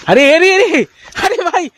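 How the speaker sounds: high laughing alarm; flustered, laughing alarm; startled laugh. Acted. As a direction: laugh in shock as she arrives. Friend storms in, shock.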